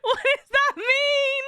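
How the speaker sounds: laughing wail. Real, not acted. Streamer repeats the question to chat as a laughing wail. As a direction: stretch it out, howling with laughter.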